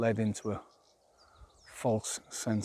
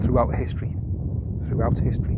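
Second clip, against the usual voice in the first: emphatic loud; 6 dB louder than usual. Real, not acted. Warning about Russia.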